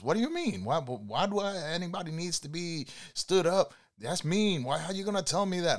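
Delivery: high, fast indignant protest; mock outraged passenger going high; indignant woman. Upset rider protests his remark.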